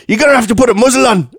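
fast, high mock scolding; hammed-up high, rapid telling-off; going ballistic. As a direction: scold the dog as its frantic owner. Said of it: "she was going ballistic with him".

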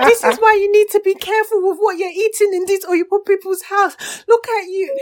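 high, loud telling-off; hammed-up scolding parent; mock parental scolding. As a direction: imitate an exasperated parent lecturing. Voices a parent scolding her.

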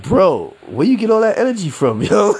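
high, amused, incredulous question through laughter; laughing disbelief. Retold or imitated. Teasing a hyped Knicks fan, laughing in disbelief. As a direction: ask it like you can't believe their energy. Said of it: "You're just like".

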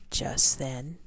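usual speaking voice

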